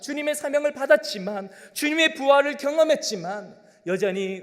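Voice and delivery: loud, high, impassioned sermon; fervent preaching. Acted. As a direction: preach with rising urgency. Preaching at full force. (열정적인 설교)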